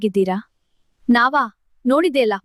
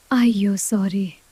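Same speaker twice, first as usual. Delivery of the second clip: slow, drawn-out sorry; sheepish apology; 3 semitones lower, 3 dB quieter, slower than usual. Acted. Tina apologising after scaring the family, sheepish.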